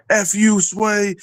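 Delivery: high jeering shout at the host; mock yelled insult. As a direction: hurl it like a freezing heckler in the crowd. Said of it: "Half of us were like".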